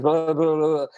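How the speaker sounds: running on in an imitated nervous babble; mock fast talker. Describing an anxious boy from a musical, mock babbling. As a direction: rattle it off into blah-blah gibberish. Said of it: "he talks so fast".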